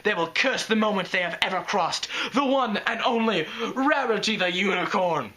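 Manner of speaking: grand theatrical diva swearing revenge; vengeful Rarity. Acted. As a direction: ham up a dramatic vow of vengeance. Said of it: "She sounds like Trixie".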